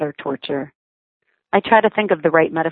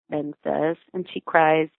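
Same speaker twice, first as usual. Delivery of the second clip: usual speaking voice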